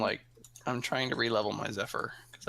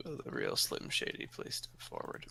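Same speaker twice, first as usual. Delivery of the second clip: mock [name] line; 13 semitones lower, 7 dB quieter than usual.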